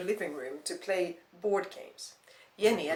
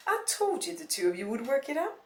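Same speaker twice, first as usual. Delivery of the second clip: higher-pitched grandmotherly I-told-you-so; cheerful triumph; 5 semitones higher, 3 dB louder than usual. A narrator's reading. Grandmother to granddaughter about making up, cheerfully triumphant. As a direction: say it pleased, pitch lifting. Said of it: "cheerful grandmother looked triumphantly".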